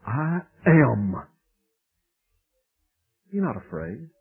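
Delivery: gentle reassurance. Acted. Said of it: "And he said".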